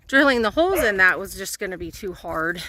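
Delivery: ordinary talk, nothing marked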